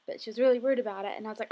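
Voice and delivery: ordinary delivery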